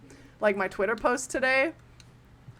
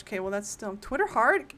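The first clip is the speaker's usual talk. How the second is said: normal talking voice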